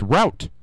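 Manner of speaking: route rhyming with out; ow vowel in route; "rout" pronunciation. Shown as a demonstration. Host on what a learner expects, route as "rout". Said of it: "supposed to be pronounced route".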